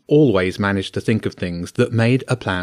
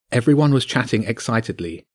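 ordinary delivery, nothing marked

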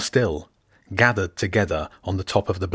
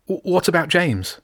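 hopeless; 9 semitones higher than usual. A narrator's reading. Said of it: "hopelessness clinging to her voice".

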